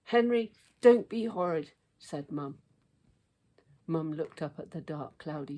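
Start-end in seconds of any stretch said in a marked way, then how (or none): 0.0-1.7 scolding Mum. A narrator's reading.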